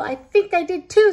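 high, loud, shaky fright; quivering voice. Acted. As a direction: tremble with fear. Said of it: "said Max in a quivering voice".

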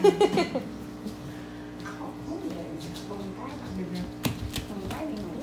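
long, soft, trailing laugh; real laugh. Real, not acted. Laughing at being pitied, long soft laugh. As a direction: laugh softly, running out of breath.

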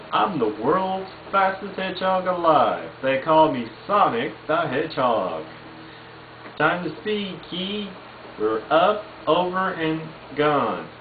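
Sonic impression. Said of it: "or Sonic".

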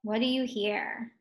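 usual speaking voice

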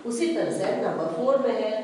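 ordinary delivery

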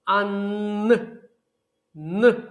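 "Н" as a short separate hummed n, no letter name; isolated consonant sound. Shown as a demonstration. Teacher picks out the next sound, "Н" sounded alone. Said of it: "наступний звук у цьому слові".

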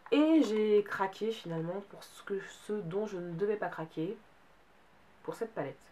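usual speaking voice

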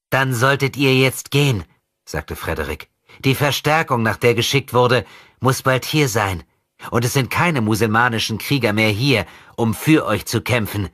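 put-on a demon in a child's body toying with his enemy; possessed boy taunting. Possessed boy mocking André's rescue, sinister.

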